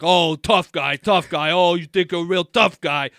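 loud, repetitive mocking taunt in imitation; taunting Jersey guy. Imitating the roommate jeering at their cursing, taunting Jersey guy. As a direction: jeer like an old-school Jersey dude. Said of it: "like, old school Italian, like, Jersey dude".

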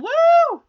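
high, loud cheering "woo"; bright burst of celebration; excited whoop. Real, not acted. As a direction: whoop with delight. Cheering about the sandwiches.

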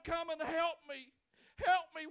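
loud, high, sobbing cry for help; a man under a fig tree begging God, crying; crying plea. Retold or imitated. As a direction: beg for help through tears. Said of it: "looking up to heaven and saying".

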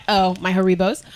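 normal talking voice

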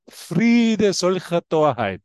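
slow, firm pronouncement; slow quote. Retold or imitated. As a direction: say it slowly and firmly. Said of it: "Der sagt, Peter". (langsam und bestimmt zitiert)

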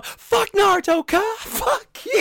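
high, loud mock yell of a meme; hammed-up shouted quote, way up high. Quoting a meme video loudly.

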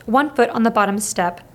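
usual speaking voice

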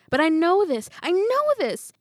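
loud, high, climbing insistence; desperate insistence. A narrator's reading. Pupil insisting to herself as memory fails, desperate.

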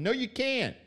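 high, slowing emphatic no; emphatic retort. Real, not acted. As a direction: spell it out, slow and pointed. Retorting to the imagined coach.

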